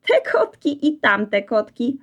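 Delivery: slow, amused, laughing voice; shaking with laughter. Acted. Reksio laughing at the two kinds of kotki, amused. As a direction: shake with laughter as you say it. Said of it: "trząsł się ze śmiechu".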